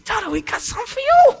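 high, bright little-kid voice mimicking his children; excited kids. A father imitating his kids handing him a card, high and delighted. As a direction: squeal it like an eager child with a gift. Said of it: "such cheerful givers of this card".

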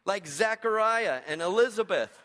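usual speaking voice

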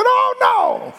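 very high, mock alarmed squeal; hammed-up mama gasp; mama's alarm. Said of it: "And mama said".

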